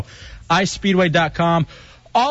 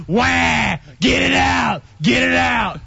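mock high yell of pain; imitating the yell; 5 semitones higher, much slower than usual. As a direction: replay his screaming, hammed-up. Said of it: "have this forever".

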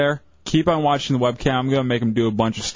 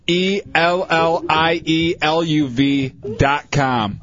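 slow spelling; much slower than usual. Shown as a demonstration. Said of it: "spelling out the site".

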